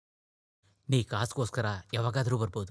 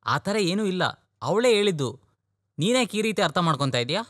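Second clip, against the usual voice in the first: higher and louder, insisting he means well; hurt protest; 6 semitones higher, 3 dB louder than usual. Acted. Brother protesting to his refusing brother, raised and hurt. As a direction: push back, wounded that he is misread.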